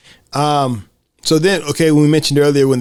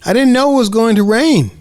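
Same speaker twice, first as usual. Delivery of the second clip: put-on pitched up, matter-of-fact child; high kid voice; 9 semitones higher, 4 dB louder than usual. Kid excusing the rain to her friend, high and carefree. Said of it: "And Mooney says".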